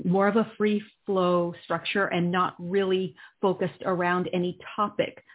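ordinary delivery

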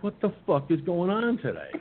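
high, incredulous outburst; fed-up cry of disbelief; exasperated disbelief. Retold or imitated. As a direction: throw up your hands at the day. Exasperated by the rude delivery.

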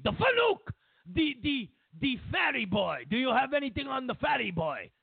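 put-on high, impatient outburst in comic Russian accent; exasperated insistence. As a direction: squawk it out, frustrated at not being understood. Exasperated Putin repeats himself.

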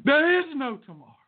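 high, quick, punchy movie-coach shout; hammed-up movie quote, pitched high; Rocky impression. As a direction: fire off the film line urgently. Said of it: "It came from Rocky".

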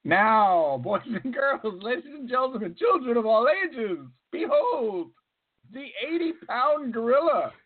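ringmaster announcing to the crowd; booming circus barker, building up. Acted. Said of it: "does the tigers and the lions".

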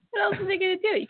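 high, laughing protest. Real, not acted. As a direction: laugh through the question. Laughing mock indignation.

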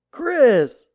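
high, loud, slow sarcastic welcome; drill sergeant's mock welcome. Drill sergeant greeting the returning deserter, loud mocking welcome. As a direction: greet him like a cat toying with a mouse. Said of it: "He's like".

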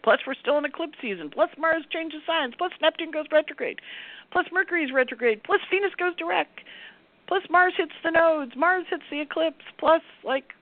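a growing list of too much at once; exasperated list. Real, not acted. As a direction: pile it on, overwhelmed and exasperated. Piling up overwhelming planetary events.

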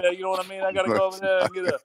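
high-pitched cartoon Jersey wise guy; hammed-up Jersey accent. Shown as a demonstration. Mocking his co-host's mozzarella order, hammed-up Jersey accent. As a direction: parrot him in an exaggerated Jersey dockworker accent. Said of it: "I can do a Jersey accent".

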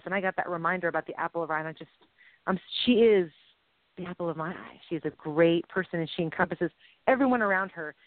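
ordinary delivery

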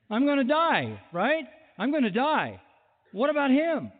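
high, whiny complaint as a worried Peter; exasperated Peter. Acted. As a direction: whine it like a man who thinks he got the worst deal. Peter whining about John's fate.

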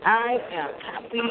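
ordinary talk

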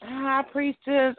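normal talking voice

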